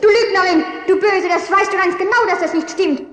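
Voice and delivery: mother shouts at the other woman in outrage; furious accusation. Acted. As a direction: hurl the accusation at the liar.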